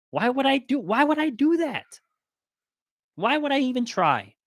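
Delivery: high-pitched incredulous questioning; indignant disbelief. Real, not acted. Refusing to fake another people's language, indignant and incredulous. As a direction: protest it, pitch jumping way up.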